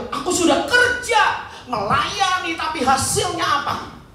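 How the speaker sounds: high, angry protest to God; Elia. Acted. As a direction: act out a prophet's indignant complaint. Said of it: "dengan mereka marah". (protes marah seorang nabi)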